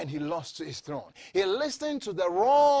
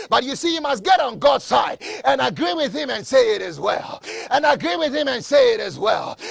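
loud, raised declaration; full-force preaching; 6 semitones higher, 9 dB louder than usual. Real, not acted. Preacher speaking life over the congregation, full-force preaching. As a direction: proclaim it forcefully over the crowd.

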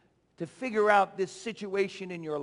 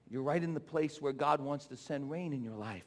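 ordinary talk

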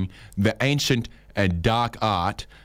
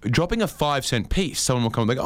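usual speaking voice

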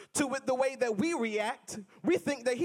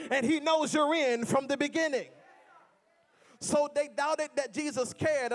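ordinary delivery

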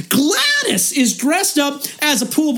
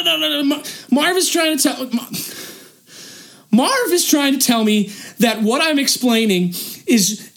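usual speaking voice

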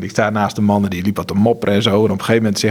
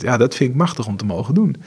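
normal talking voice

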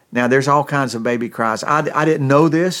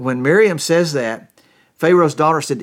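normal talking voice

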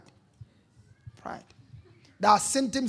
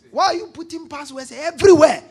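higher, louder accusing question; loud challenge; 6 semitones higher, 9 dB louder than usual. Acted. Preacher voices a partner questioning passwords, loud challenge. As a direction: demand an answer, voice raised. Voicing a suspicious partner's demand.

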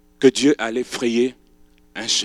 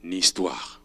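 usual speaking voice

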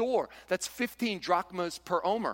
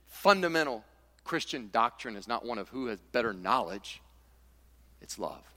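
usual speaking voice

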